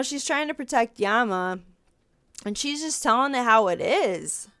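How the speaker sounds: normal talking voice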